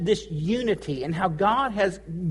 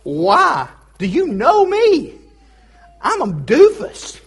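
high, loud, disbelieving and slow; mock bewildered protest at being thanked; comically baffled, pitch leaping up; incredulous self-talk; 6 semitones higher, 7 dB louder, slower than usual. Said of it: "And I thought to myself".

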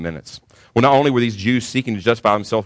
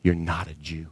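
much softer, lower, dropped almost to a murmur; quiet repeat; 3 semitones lower, 7 dB quieter than usual. Acted. Repeated quietly after long pause.

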